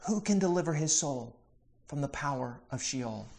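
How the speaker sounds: soft, pondering question, fading away; hushed and unhurried, with a pause midway; letting it sit. Retold or imitated. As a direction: leave the question hanging, dropping to a hush. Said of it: "he just lets it sit".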